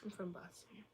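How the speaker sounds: very soft, trailing away; barely audible repeat. Real, not acted. Repeating the answer to where you're from, very quietly. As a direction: let the repeat almost vanish.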